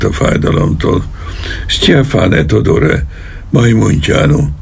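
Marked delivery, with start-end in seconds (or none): 1.7-4.5 a high, slow call toward the door; yelling for help. A narrator's reading. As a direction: call your men in from the next room. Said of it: "Üvöltött elkékülve az ajtó felé". (kétségbeesett kiáltás az ajtó felé)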